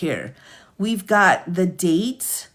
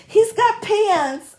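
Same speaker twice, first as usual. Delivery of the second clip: high, drawn-out delighted squeal; tearful delight; 3 dB louder, slower than usual. Real, not acted. Streamer seeing gingerbread man has pants, tearful delight. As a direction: squeal it slowly, overcome with joy. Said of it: "I'm trying not to cry".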